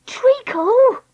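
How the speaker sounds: high, sharp call of a name; warning. Acted. Dakota warning Treacle as the trolley wobbles, sharply. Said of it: "Dakota warned".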